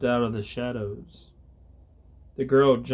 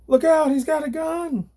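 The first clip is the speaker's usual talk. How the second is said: much higher, louder scream of warning; frightened scream; 5 dB louder than usual. Acted. Jessica screams a warning to Gabriel, high and loud. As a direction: cry out in terror to warn him. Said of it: "Jessica screamed".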